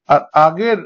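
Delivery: usual speaking voice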